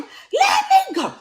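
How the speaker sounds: high, loud retold scream; shrieking to be let go. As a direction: scream it at the top of your lungs. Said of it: "I started screaming at the top of my lungs".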